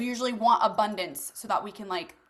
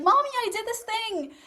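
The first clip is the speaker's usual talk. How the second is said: put-on high-pitched little kid voice; put-on child's voice, pitched way up; child to mommy; 9 semitones higher than usual. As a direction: pipe up like a kid telling mom. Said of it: "when you were a kid".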